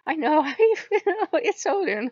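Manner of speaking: high, loud teasing god voice; voicing Odin. Odin teasing the crying wolf in a high loud voice. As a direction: pitch it up and tease like a god.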